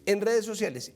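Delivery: normal talking voice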